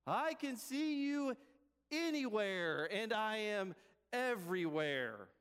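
slow, drawn-out, booming-style proclamation; high start, stately and slow; voice of God. Acted. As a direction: stretch each word grandly from on high. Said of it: "And the voice says".

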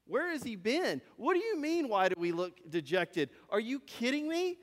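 can't believe what you just heard; incredulous. Acted. Travelers reacting to a clueless stranger, incredulous.

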